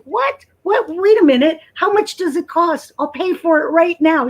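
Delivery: shrill-high yelp of retold shock, then eager; imitating her own stunned self, ready to pay; mock disbelief. To her friend who says it's a process, high retold shock. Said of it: "And I'm like".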